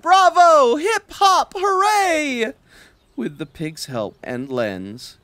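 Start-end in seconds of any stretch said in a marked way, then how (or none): 0.0-2.5 high, loud cheer. Acted. The cow cheering her friends, high and loud. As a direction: cheer with all your heart. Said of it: "Clorinda cried".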